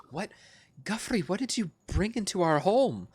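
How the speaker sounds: high, startled question; recoiling in alarm at the thing; shocked recoil. Acted. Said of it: "she kind of recoils back and says".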